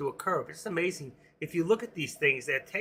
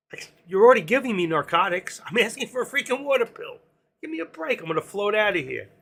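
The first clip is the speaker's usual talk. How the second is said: retold indignant protest, pitched up high; exasperated comeback; 6 semitones higher, 5 dB louder than usual. Patient arguing with doctor for a water pill, exasperated. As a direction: argue back in mock outrage, voice climbing.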